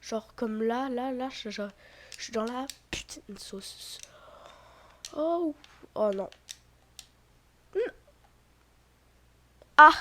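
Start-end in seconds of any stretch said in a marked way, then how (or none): 5.2-9.9 dismayed groan. Real, not acted. Losing the round.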